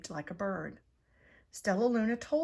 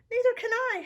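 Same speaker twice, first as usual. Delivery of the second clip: higher and louder wail; frightened howl; 9 dB louder than usual. A narrator's reading. Flitter lost in the dark, frightened high howl. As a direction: howl in fear. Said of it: "howled Flitter".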